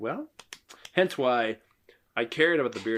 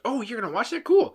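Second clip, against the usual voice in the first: high, eager retold reaction; upbeat mimicry of a keen partner; imitating excited wife; 12 semitones higher, 4 dB louder, faster than usual. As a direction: pitch it right up, imitating her excitement. She was very excited.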